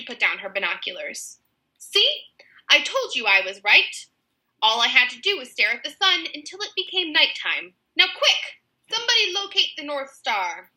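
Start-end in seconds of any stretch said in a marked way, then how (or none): 1.8-10.7 high, smug Strong Arm; Strong Arm's voice. A narrator's reading. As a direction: play the proud, silly kidnapper, pitched up.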